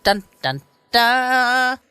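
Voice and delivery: mock suspense fanfare; dramatic sting. Dramatic sting before show title.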